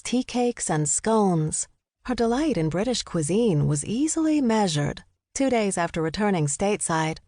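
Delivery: ordinary delivery, nothing marked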